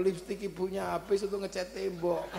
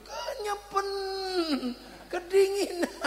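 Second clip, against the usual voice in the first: imitating child; 12 semitones higher than usual. Child shivering in bath.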